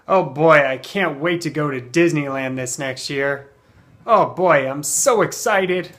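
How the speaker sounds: put-on loud, eager "oh boy"; acted happy excitement; positive, excited. Shown as a demonstration. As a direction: gush with anticipation, stretching the key words. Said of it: "in a positive way".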